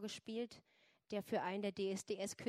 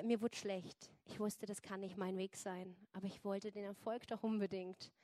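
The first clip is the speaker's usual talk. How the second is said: usual speaking voice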